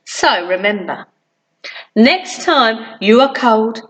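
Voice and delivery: ordinary delivery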